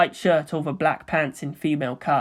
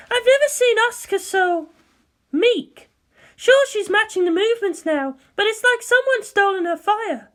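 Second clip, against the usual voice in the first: Misato's voice. Acted. Misato telling Ritsuko something's off, higher and uneasy. Misato worries about Asuka.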